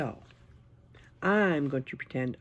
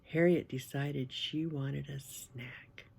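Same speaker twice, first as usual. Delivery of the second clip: usual speaking voice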